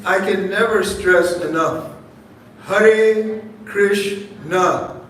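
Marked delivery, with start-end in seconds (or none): 2.6-5.0 Hare Krishna stretched out very slowly, every syllable held; slow, deliberate. Shown as a demonstration. Preacher modelling attentive chanting for listeners, very slow. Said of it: "Four syllables".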